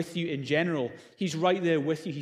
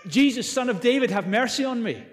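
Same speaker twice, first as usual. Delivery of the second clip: higher, louder plea; crying out; 7 semitones higher, 5 dB louder than usual. Retold or imitated. Blind man calling to Jesus, crying out for mercy. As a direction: call out for mercy from the roadside. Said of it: "the blind man cried out".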